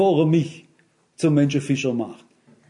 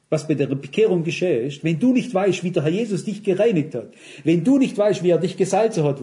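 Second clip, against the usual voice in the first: usual speaking voice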